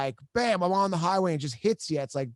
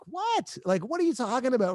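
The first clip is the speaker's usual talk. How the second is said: much higher, incredulous "what?"; retold disbelief; 7 semitones higher than usual. Son hearing his sister report dad's death, disbelieving. Stunned reply to death news.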